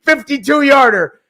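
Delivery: rising, hopeful game call; announcer call. Acted. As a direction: set up the big kick like a broadcaster. Announcer calls the kick.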